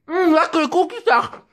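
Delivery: high, loud, hammed-up lip-smacking eater; squeaky mock foodie relishing cookies. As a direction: ham up the man savouring his cookies. Mimicking the noisy cookie eater.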